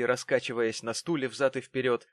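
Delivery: plain reading voice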